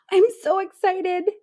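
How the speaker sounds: high, loud excited squeal. A narrator's reading. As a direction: burst out with excitement. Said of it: "Jim exclaimed".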